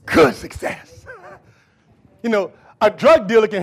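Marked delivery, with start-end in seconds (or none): 0.0-1.1 loud scripture proclamation with drawn-out words; full-force preaching. Acted. Preacher reading the promise to the congregation, full-force preaching. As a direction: ring out the promise, stretching key words.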